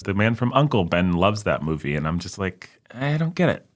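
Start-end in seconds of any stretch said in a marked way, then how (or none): 2.9-3.7 high-pitched, apologetic protest; raised pitch, helplessly confessing; acted bafflement. Acts out own bafflement at film.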